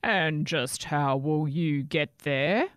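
slow, growled troll taunt; growling troll. Acted. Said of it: "growled the troll".

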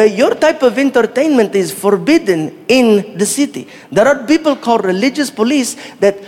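put-on Saudi-accented driver breaking it down; explaining in Saudi accent. Driver explaining local rules to a visitor, in accented English. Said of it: "then he broke it down".

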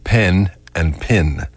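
slow, separate "Pen" with eh and "pin" with ih; northern distinction. Shown as a demonstration. Showing listeners the northern way, distinct Pen and pin. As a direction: spell out the vowel difference in Pen and pin. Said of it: "Up north, they say".

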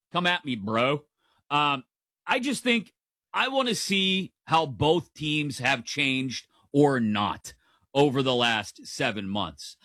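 ordinary talk, nothing marked

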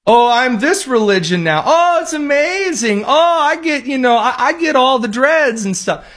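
mock voice of the prisoner gushing about his new religion; mocking high voice. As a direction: ridicule the claim in a sing-song falsetto.

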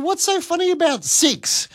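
high, baffled mock innocence; bewildered high-pitched query; puzzled question. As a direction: ask it like you truly don't get the joke. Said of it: "And I said".

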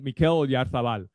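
Oyarzabal with a Spanish z, said like th; Spanish accent. Real, not acted. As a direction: say the name the way a Spanish announcer would. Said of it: "I'm saying it with a Spanish accent".

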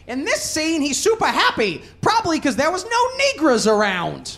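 high, loud old director impression; hammed-up director commentary, pitched well up; old film director. Director on a commentary track explaining a scene, hammed-up old-timer. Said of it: "you hear the director say".

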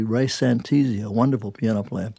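usual speaking voice

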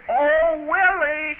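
drawn-out, high-pitched mimicked call; mock holler. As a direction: indignantly imitate a little sister hollering. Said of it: "she hollered at me".

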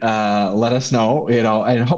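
normal talking voice

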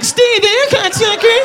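very high squeaky doughboy insult. Retold or imitated. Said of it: "The Pillsbury Doughboy's like".

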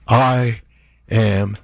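emphatic, one word at a time; real anger, with pauses between the words; angry. Real, not acted. Host summing up his grievances to listeners, firmly angry.

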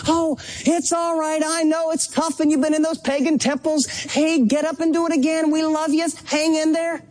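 a fond father urging his son to get up and go again; encouraging dad. Retold or imitated. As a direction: cheer on a son who keeps falling, like a loving father. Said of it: "We were the dad who said".

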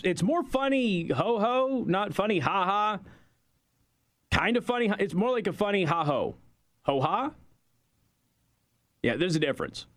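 ordinary talk, nothing marked

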